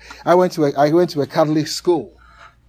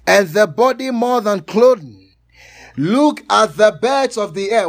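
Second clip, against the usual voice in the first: slow, louder solemn reading of scripture; 5 semitones higher, 5 dB louder, slower than usual. Acted. Preacher reading Matthew six to the congregation, slow solemn reading. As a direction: lay each phrase down slowly.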